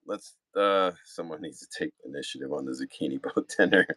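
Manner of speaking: ordinary delivery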